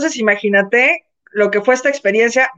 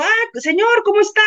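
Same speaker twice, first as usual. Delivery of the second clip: high, courteous hello; polite greeting; 9 semitones higher than usual. Retold or imitated. Greeting [name] in the hotel, lightly high and polite. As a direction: greet a star sweetly, lightly put on. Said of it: "Y yo".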